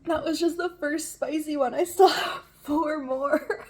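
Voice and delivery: half laughing, half crying. Real, not acted. Spicy pain, laughing and crying.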